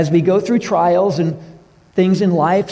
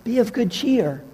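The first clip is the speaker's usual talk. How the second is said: light, higher, softer greeting; gentle greeting; 7 semitones higher, 4 dB quieter than usual. Retold or imitated. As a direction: greet them kindly, a touch up in pitch. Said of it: "Walks up to someone else".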